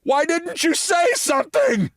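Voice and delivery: very high, shouted protest; indignant yell. Retold or imitated. Fourteen-year-old yelling at the first baseman, high indignant shout. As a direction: fling the blame back at him in a yell. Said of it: "was to say what I said".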